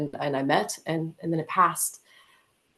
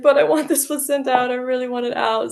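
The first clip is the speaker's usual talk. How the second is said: urgent plea; 8 semitones higher, 5 dB louder, faster than usual. Retold or imitated. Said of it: "I'm like".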